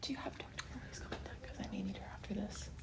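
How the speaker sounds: hushed whisper aside. Pretend. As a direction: whisper it like a secret request. Joking she needs her therapist.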